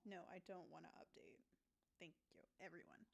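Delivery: whispered aside. Real, not acted. Streamer whispering at an update prompt. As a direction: hush it to the screen.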